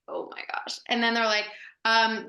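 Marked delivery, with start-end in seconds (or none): 0.1-0.8 whispered, aghast aside; voiceless, much softer mock dismay; hushed dismay. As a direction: imitate onlookers muttering under their breath. Others' hushed dismay, retold.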